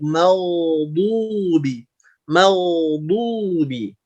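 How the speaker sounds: very slow "meldubi", opened as dark "mau". Shown as a demonstration. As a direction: draw out "meldubi" thick, lips not pushed forward. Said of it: "Tapi pangkalnya yang naik". ("meldubi" pelan dan tebal, pangkalnya naik)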